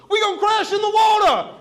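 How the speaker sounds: very high, loud mock shriek; hammed-up alarm at full volume; panicked scream. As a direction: mimic a passenger yelling in panic.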